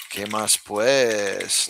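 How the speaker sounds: slow, drawn-out Paisa greeting; Paisa accent. Shown as a demonstration. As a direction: say it like a local from Medellín. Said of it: "this is very Paisa".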